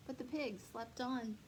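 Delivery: ordinary delivery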